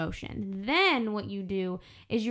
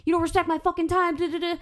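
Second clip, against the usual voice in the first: loud, high, heated rant at Taylor; triggered angry outburst; 7 semitones higher, 4 dB louder than usual. Pretend. Venting at Taylor for being late, high and loud. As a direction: snap at a late friend in full fury. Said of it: "instead of now being triggered and saying".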